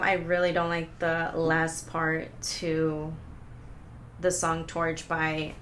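flat, soft robot voice. Real, not acted. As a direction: drone it like a robot, quiet. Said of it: "Said that also like a robot".

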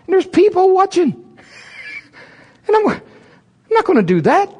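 very high, loud nervous protest; squirming under watching eyes; high frantic refusal; panicked. Retold or imitated. Panicked retelling.

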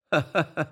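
short dry "heh-heh-heh" chuckle; acted chuckle. Driver hearing the policeman's wild report, acted chuckle. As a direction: laugh off the accusation briefly.

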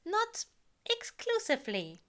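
high, loud flustered protest. A narrator's reading. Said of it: "Aziraphale protests".

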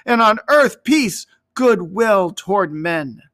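high, loud jubilant proclamation, broadening at the end; exultant heavenly voices. A narrator's reading. Heavenly host praises God over the fields, exultant high proclamation. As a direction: proclaim praise like a celestial chorus. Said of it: "heavenly voices singing".

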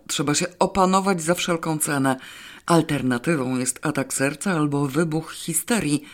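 ordinary delivery

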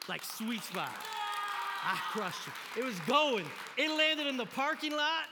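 from strong to loud, high hype; building triumph. Retold or imitated. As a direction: call a home run, rising to a shout. Building the big hit, then anticlimax.

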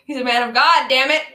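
loud, exasperated mock protest; thundering in mock indignation; mock outrage. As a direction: protest loudly as if scandalized. Mock outrage at film's logic.

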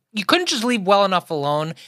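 ordinary talk, nothing marked

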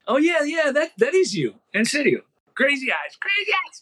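mucho más agudo, casi gritando de emoción; emoción eufórica. Real, not acted. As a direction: reconoce al personaje y se le escapa un chillido de alegría. Reconoce a Crazy Eyes emocionado. (emocionado al reconocer a su colega como Crazy Eyes, casi gritando)

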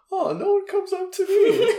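high, laughing mock dismay; laughing high squeal. Comic noticing no fans came, laughing high dismay. Realizing nobody approached her.

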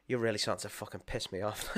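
put-on a hushed, half-laughing grumble at the baby; soft mock irritation with a laugh in it; mock exasperated mutter. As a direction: say it low under your breath, amused. Frustrated dad quoted to crying baby.

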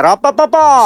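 mouth fanfare. Pretend.

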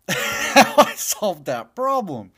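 slow words broken by a laugh. Real, not acted. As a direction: laugh while admitting it. Laughing at own confusion.

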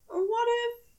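prim character voice lifted high, exasperated; Aziraphale flustered. Acted. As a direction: plead high and wounded, defending himself. Aziraphale prompts Crowley.

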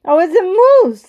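high, loud burst of discovery; excited exclamation. A narrator's reading. Man recognises the moose, exclaiming excitedly. Sudden sighting in the story.

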